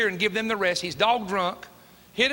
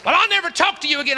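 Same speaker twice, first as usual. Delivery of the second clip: angry retort; 9 semitones higher, 6 dB louder, faster than usual. Retold or imitated.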